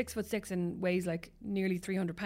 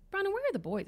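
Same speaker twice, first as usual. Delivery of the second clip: higher call across the house, questioning; calling out to husband; 11 semitones higher than usual. Retold or imitated. Mother calling to husband in the kitchen, high calling voice. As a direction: call out to Brandon in the next room.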